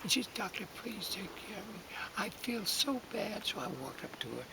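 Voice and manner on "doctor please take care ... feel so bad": put-on high, whispered plea of a sick old woman; weak pleading whisper. Bedridden woman begging the doctor for help, whispered. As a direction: breathe it out like a frail patient.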